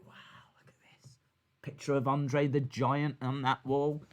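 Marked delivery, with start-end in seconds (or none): 0.1-1.2 whisper. Pretend. Awed new hire in the meeting.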